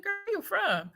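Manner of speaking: friend's voice. Retold or imitated. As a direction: voice the friend lightly.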